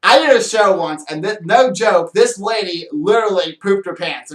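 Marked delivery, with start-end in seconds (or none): none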